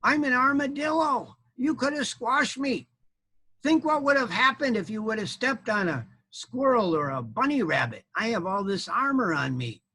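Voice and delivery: high-pitched, scolding animal voice; put-out storybook armadillo; indignant armadillo. Acted. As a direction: scold the clumsy knight as a small critter.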